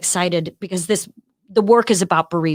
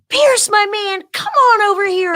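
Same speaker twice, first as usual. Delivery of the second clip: hearty President Biden; 13 semitones higher, 5 dB louder than usual. Retold or imitated. President greeting son warmly.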